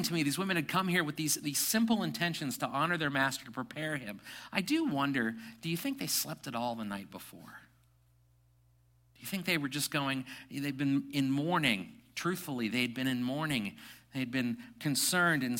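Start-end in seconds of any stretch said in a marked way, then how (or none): none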